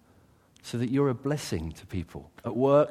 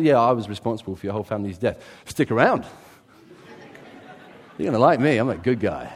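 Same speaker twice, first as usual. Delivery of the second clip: mock breezy offer; 7 dB louder, faster than usual. Mock paraphrase of David's words.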